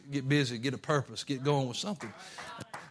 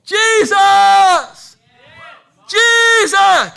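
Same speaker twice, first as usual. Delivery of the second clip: a full-voiced cry, far higher and louder; shouting; 17 dB louder, much slower than usual. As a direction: scream his name across the crowd. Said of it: "he cried even more".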